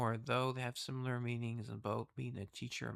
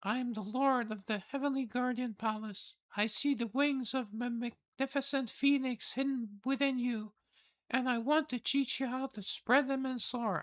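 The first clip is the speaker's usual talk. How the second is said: high, wondering noble voice; awed noble lady; 11 semitones higher than usual. Acted. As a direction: marvel at a hidden talent. Reads palace lord's lines.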